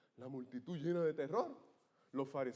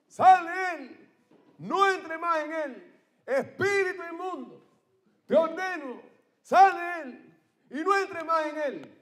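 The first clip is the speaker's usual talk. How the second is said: slow, booming command, much higher and louder; commanding rebuke; 10 semitones higher, 9 dB louder, slower than usual. Acted. Jesus ordering the unclean spirit out, slow booming command. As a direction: cast the spirit out with full force. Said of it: "le dijo".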